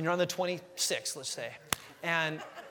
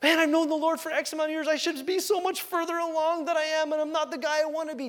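high frustrated self-quote; 10 semitones higher, 4 dB louder than usual. Retold or imitated. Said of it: "I'm like".

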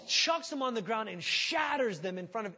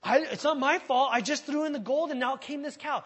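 higher, louder defensive whine; whiny excuse; 6 semitones higher, 7 dB louder than usual. Retold or imitated. Aaron whines excuses to Moses, higher and louder. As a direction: plead innocence like a caught kid. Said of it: "that's what he tells Moses".

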